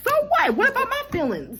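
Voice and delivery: high-pitched mock outrage; exasperated acted protest; indignant comeback. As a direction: throw it back, voice leaping way up. Acted comeback in a retold quarrel.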